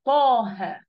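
"طه" loud and long with a heavy ط; emphatic ta. Shown as a demonstration. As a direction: push "طه" out from a full mouth. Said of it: "أدي كده الطه".

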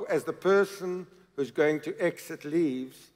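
normal talking voice

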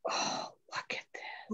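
awed whisper; hushed awe. Real, not acted. Crafter opening a new ribbon, hushed awe. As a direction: breathe it out softly in wonder.

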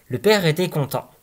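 ordinary delivery

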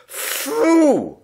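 high, loud puff of breath; a boy blowing out birthday candles; big candle-blowing puff. A narrator's reading. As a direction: blow out all the candles at once. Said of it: "blew out all the candles".

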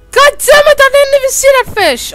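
furious shout. Real, not acted. Gamer raging at a fish he never saw, furious shout. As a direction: explode at the unseen fish.

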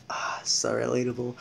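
soft breathy "so relatable"; whispered aside. Real, not acted. Aside to the viewers, whispered. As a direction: whisper it to yourself.

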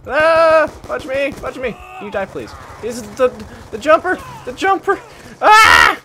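loud, high frustrated yells; frustrated yelling. Real, not acted. Gamer swarmed by enemies, loud high frustrated yelling.

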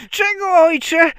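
put-on loud, very high child's shout; Bździelik. A narrator's reading. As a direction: call back as a tiny boy running up. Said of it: "zawołał nadbiegając Bździelik". (piskliwe zawołanie malca)

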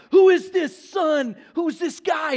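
a king calling out who the newcomer is; King Saul demanding loudly. Retold or imitated. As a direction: Saul booming at the young giant-killer. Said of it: "he's like".

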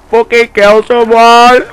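loud raging shout, dragged out; angry yell. Real, not acted. Gamer raging at teammates on screen, loud angry shout. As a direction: rage at the screen.

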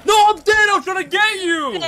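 high, loud frustrated yell; frustrated shout. Real, not acted. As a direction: shout it out in despair. Dies while trying to help.

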